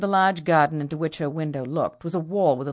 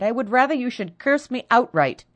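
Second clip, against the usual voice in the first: higher, louder plea; stung sister crying out, higher and louder; 8 semitones higher, 5 dB louder than usual. Acted. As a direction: cry it out in hurt. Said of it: "she cried".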